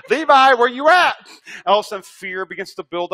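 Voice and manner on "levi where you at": high, loud call of a name; dad hollering for his son, loud and high. Retold or imitated. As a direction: call the boy across the store. Father calling for lost son.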